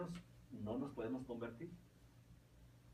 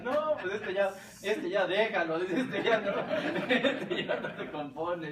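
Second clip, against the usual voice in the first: loud, high, breaking into laughter; laughing priest's verdict; 5 semitones higher, 10 dB louder, slower than usual. Retold or imitated. Priest telling the old woman her son is beyond saving, loud and laughing. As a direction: bellow the punchline while cracking up.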